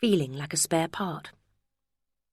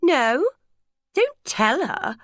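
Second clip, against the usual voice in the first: sharp, higher, louder No then slowed plea; urgent interruption; 9 semitones higher, 5 dB louder, much slower than usual. A narrator's reading. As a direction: cut in fast to stop him. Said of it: "Romy interrupted".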